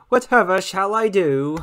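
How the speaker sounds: hammed-up lament, rising at the end; melodramatic host. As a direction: play the helpless damsel. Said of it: "dramatic host then plain repeat".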